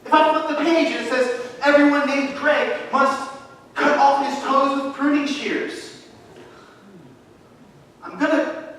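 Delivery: high, loud, fervent declaration; full-force preaching. Real, not acted. Pastor declares to his church he would obey at once, fervent shout. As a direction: preach it out with conviction.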